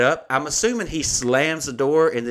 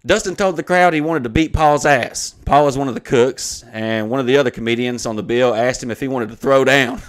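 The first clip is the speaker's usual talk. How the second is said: louder, punched-out official wording; email read-aloud; 5 dB louder than usual. Acted. Venue citing its mask mandate, loudly read aloud. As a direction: declaim the written accusation word by word. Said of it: "I'm gonna read the email".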